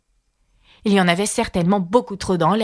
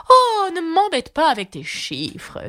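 higher, slow irritated outburst; a touch of annoyed shout; exasperated cry; 7 semitones higher, slower than usual. Acted. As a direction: snap at her, fed up. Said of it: "s'écria la duchesse".

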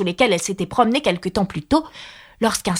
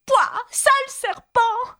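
piercingly high, frantic pigeon; high-pitched shriek. A narrator's reading. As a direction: screech the complaint at the top of your range. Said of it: "monta jusqu'à devenir un cri aigu".

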